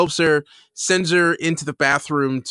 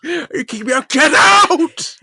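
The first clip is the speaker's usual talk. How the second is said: much higher, louder get out through laughter; imitating Frasier, breaking into laughter; laughing shout; 14 semitones higher, 9 dB louder, slower than usual. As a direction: crack up while yelling it. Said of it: "he just goes".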